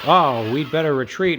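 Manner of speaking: mock soldier voice. Mocking the game's soldiers.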